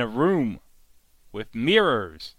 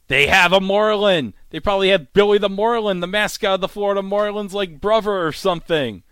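louder, fast, high exasperated rant; mock outrage rant; 5 semitones higher, 5 dB louder, faster than usual. Imitating Arthur ranting about the marlin, fast and loud. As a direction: rattle it off in mock fury.

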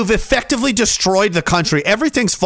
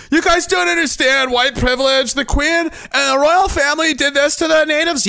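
mock preachy critic; 8 semitones higher than usual. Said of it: "mocking critics".

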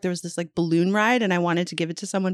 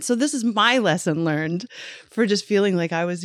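ordinary talk

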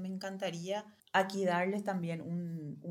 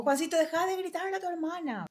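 higher and louder, a sharp mock scolding; scolding parent; 10 semitones higher, 4 dB louder, faster than usual. Parent scolding a son for yelling, mock scolding. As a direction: snap at the kid like a fed-up parent. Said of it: "En vez de decirle".

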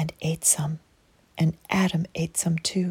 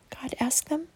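ordinary delivery

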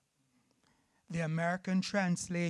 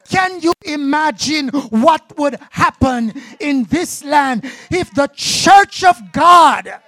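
shouted, high-pitched sermon appeal; impassioned preacher at full cry; preaching climax; 12 semitones higher, 21 dB louder than usual. Acted. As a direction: build to a full shout, hammering each phrase.

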